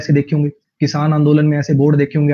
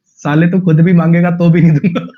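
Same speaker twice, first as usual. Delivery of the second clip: mocking commenter; 4 dB louder than usual. Teacher acts out a funny meme comment, loud and cheeky. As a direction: fire it back as a cocky troll. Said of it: "कहता".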